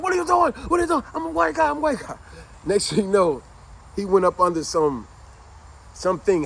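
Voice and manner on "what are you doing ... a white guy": panicked plea. Retold or imitated. Soldiers draw guns on him.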